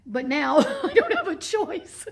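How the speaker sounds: laughing through the words at her own tears. Real, not acted. To viewers, laughing about crying all the time, laughing.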